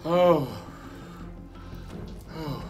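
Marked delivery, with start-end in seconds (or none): 0.0-0.5 loud pained groan; pained cry. Real, not acted. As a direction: cry out in burning pain. Spicy pain outburst.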